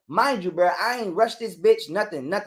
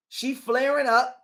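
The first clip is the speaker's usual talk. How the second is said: ordinary talk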